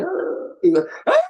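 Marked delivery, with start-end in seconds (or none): 0.0-0.5 high, softer mock wail; mock group crying. Everybody crying at the funeral, mock wail. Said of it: "Everybody going". 1.1-1.2 sudden loud mock wail; louder mock crying. Devotee crying louder than everybody at the funeral, loud mock wail. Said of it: "cry more louder than everybody else".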